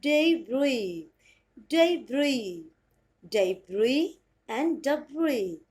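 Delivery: very slow "debris and debris", each syllable drawn out; slow careful demo. Shown as a demonstration. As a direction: spell the sounds out slowly for learners. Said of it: "And the next pronunciation is".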